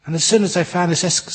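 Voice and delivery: ordinary delivery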